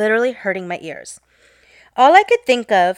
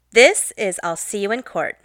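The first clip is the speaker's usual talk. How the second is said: usual speaking voice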